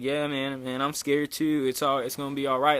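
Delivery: ordinary delivery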